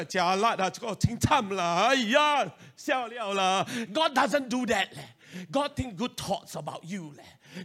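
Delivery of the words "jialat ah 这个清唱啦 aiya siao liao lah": mock fretting Singaporean. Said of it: "God doesn't think".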